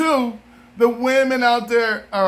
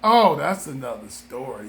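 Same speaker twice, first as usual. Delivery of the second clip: ordinary delivery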